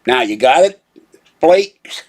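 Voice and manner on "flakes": "Flakes?" punched high as a question; emphatic jab on "Flakes"; stress on flakes. Shown as a demonstration. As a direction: hit "Flakes" hard and high. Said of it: "use emphasis on the word flake".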